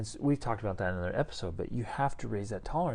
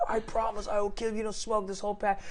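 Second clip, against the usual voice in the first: high, raised menacing voice; retold threat; 11 semitones higher, 3 dB louder than usual. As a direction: promise violence, shouting. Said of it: "he's like".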